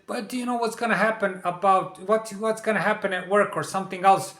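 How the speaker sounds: lightly imitating a pushy boss; mock menacing boss on the phone; boss's voice.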